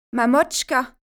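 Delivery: exclaimed, pitch lifted; crying out. A narrator's reading. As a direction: call out for your mother. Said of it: "Je m'écrie".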